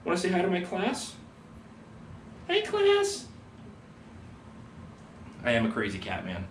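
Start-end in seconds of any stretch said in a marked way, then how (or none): 2.5-3.3 very high squeaky cat hello; cat greeting voice. Pretend. Teacher makes his cat say hi to students, squeaky high. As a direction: make the cat greet the class.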